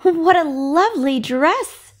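high, slow, delighted gasp; mild breathless wonder; delighted cry. Acted. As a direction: marvel at the dress. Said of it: "she cried".

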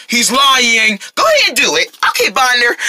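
normal talking voice

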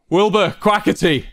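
high, loud call to two names; shout. A narrator's reading. Ranbu shouting at the two who are fighting, shout. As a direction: shout their names to get their attention. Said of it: "Ranbu began to shout".

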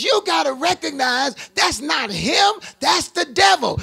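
high-pitched, building preacher's cry; forceful preaching. Acted. Preacher warning couples about the devil in the house, rising forceful preaching.

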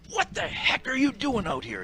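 high, sharp scolding bark; mock angry coach. Coach scolding the struggling ref from the bench, mock angry. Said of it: "He said".

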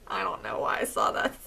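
usual speaking voice

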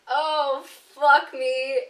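resigned exclamation. Retold or imitated. Resigned before the jump.

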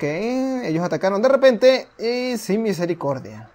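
lightly dramatic storyteller read; measured, solemn narrator; story narration. A narrator's reading. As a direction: tell the old war like a legend.

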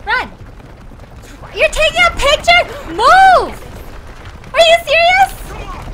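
exasperated yelling. Real, not acted. Streamer yelling at Lara for snapping photos, outraged. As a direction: scold the character at full volume.

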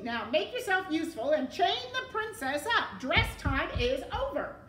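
high, loud commanding rat; bossy rat villain. A narrator's reading. Rat ordering the serving girl to chain the princess, bossy. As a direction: order her about loudly as the rat.

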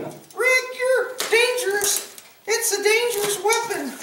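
high, slow nagging imitation; mock fretting viewer. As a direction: mimic a worried scold in falsetto. Voices fretting viewers mockingly.

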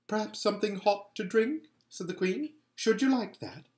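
put-on queen suddenly coaxing the cold boy; sweetly different voice. A narrator's reading. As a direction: lure him in gently.

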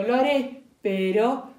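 loud, rising alarm from the fox; shouted. Acted. Fox shouts at the piglet in alarm, loud. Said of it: "gritó el zorro".

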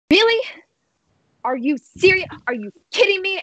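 high-pitched, incredulous outburst; exasperated disbelief. Acted. Ollie banging on Nolly's door at one a.m., exasperated. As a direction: demand it in indignant, high-voiced disbelief.

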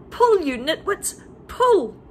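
high, loud tugging command; shouted order. A narrator's reading. Mugglewump yelling at the nitwits to pull. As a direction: yell it while hauling.

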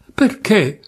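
sudden high outburst; bitter outburst at the question; bursting out. A narrator's reading. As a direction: blurt it out, high and sharp. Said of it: "proruppe lei".

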